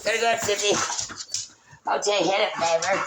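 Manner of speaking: high, sing-song pet voice. Real, not acted. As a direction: coo at the puppy excitedly. Baby talk to her dog.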